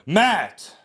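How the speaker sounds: self-scolding shout. Real, not acted. Scolding himself for stumbling.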